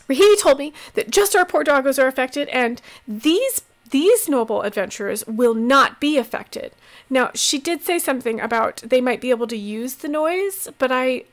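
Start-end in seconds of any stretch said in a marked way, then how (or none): 0.1-6.7 flustered. Acted. Healer rushes on after tears.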